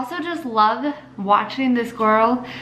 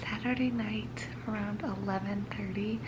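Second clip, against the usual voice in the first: late-night whisper so no one wakes; 10 dB quieter than usual. Real, not acted. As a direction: hush it, someone's asleep nearby. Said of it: "I'm kinda whispering because".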